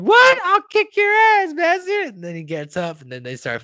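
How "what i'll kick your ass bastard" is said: high, loud squawked threat; hammed-up outrage, much higher and louder; mock angry Tweek. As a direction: spit out a challenge in a high cartoon voice. Retold challenge before a fight.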